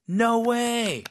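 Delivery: very high, thrilled exclamation; excited. Shown as a demonstration. Teacher recapping four no ways, excited. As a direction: squeal "no way" with excitement.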